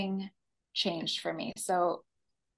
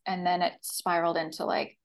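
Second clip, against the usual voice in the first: usual speaking voice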